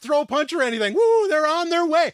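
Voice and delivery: giddy triumphant whoops; excited. Real, not acted. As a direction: celebrate like you just won. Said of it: "sorry for all the excitement".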